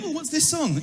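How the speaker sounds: ordinary delivery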